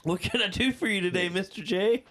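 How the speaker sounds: high, eager servant voice; cheerful robot servant. Pretend. Robot greets its owner, a high, eager servant voice. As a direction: chirp it up like a helpful home robot.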